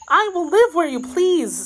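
high, submissive maid's voice; eager maid. Acted. Kitty agreeing to live anywhere, high and eager. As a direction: pitch it high and eager to please.

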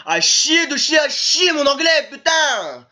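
loud, high-pitched cursing rant; angry outburst. Real, not acted. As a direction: get carried away cursing your own English. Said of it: "des fois ça m'arrive de m'emporter".

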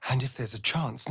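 usual speaking voice

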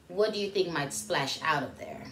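ordinary delivery, nothing marked

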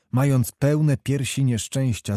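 usual speaking voice

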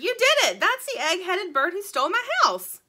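high, loud little-bird outburst; excited scream. A narrator's reading. Little bird naming who stole her house, screamed excitedly. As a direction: cry out in triumphant accusation. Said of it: "screamed the little bird".

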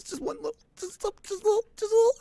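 begging the pet for one squeak; pleading squeak. Acted. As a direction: coo up high like coaxing a bird.

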